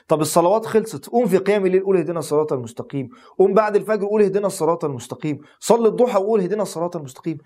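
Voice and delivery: preaching at full force. Real, not acted. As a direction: drive each call home like a sermon. Preaches repeated call to pray.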